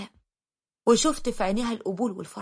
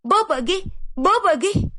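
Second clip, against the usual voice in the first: put-on high, loud child calling for mama; excited little daughter's shout, pitched up; calling out loudly; 8 semitones higher, 5 dB louder than usual. A narrator's reading. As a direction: call urgently across the house. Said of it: "وبتقول بصوت عالي".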